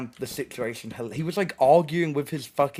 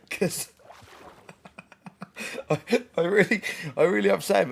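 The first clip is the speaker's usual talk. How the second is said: laughing; 7 semitones higher, faster than usual. Real, not acted.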